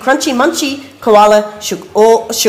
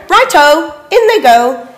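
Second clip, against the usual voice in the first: higher eager dingo voice; 7 semitones higher, 5 dB louder than usual. A narrator's reading.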